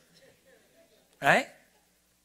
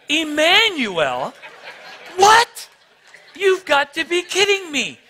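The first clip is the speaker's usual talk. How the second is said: much higher, laughing astonishment; disbelief; 11 semitones higher, 6 dB louder, slower than usual. Retold or imitated. Reacting to the name Emmanuel.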